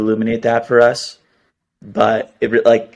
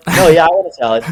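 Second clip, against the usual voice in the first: higher, lightly put-on voice with a laugh; switched persona; 6 semitones higher, 4 dB louder, faster than usual. Host acting out a lunch order, lightly put-on persona. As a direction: play the persona backtracking, half laughing.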